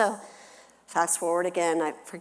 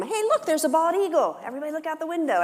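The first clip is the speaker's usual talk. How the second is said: high, fast mock excitement; fake distraction; 10 semitones higher, 4 dB louder, faster than usual. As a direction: loudly point out the eagle to change the subject. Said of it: "I wanted to change the subject".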